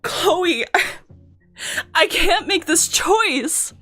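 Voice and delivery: high, drawn-out, tearful protest; slow, high-pitched despair; anguished plea. Acted. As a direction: plead as if torn apart. Acted anguish over the choice.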